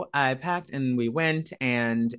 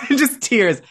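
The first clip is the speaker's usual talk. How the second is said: laughing; 10 semitones higher, 8 dB louder than usual. Real, not acted. Laughing while recalling tears.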